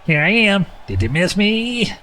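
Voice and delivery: high, slow, smug octopus voice; gloating villain. Acted. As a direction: tease like a cocky villain. Ultros voiced by player.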